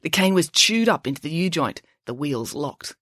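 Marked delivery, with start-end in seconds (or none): none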